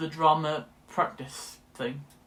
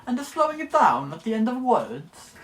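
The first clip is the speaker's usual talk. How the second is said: Mario-like Italian, high and loud; hammed-up Italian accent; 5 semitones higher, 6 dB louder than usual. Shown as a demonstration. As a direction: put on cartoon Italian, punching random words. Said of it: "but I can do Italian".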